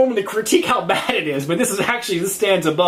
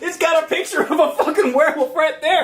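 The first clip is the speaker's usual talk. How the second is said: high, laughing exasperation; laughing rant; 9 semitones higher than usual. Real, not acted. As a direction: rant while cracking up.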